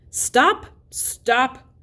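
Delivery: high, loud urgent cry; shouted command. A narrator's reading. Thorin yelling at the dwarves to stop shooting, loud. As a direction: yell it to halt the dwarves too late. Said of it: "shouted Thorin".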